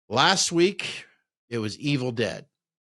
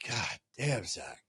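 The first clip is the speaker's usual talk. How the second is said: groggy mutter; 3 semitones lower, 11 dB quieter, slower than usual. Retold or imitated. Waking at 3am, groggy complaint.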